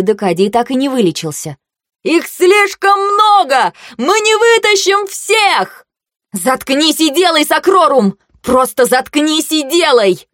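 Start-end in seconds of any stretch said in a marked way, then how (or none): 2.0-5.9 very high, slow desperate yell; shouting over wind. Acted. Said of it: "пытается перекричать ветер". 6.3-10.3 angry command. Acted. Commander yells in storm.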